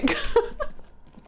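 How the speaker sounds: airy, rising nervous laugh; breathless panicked laugh. Real, not acted. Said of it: "I'm panicking".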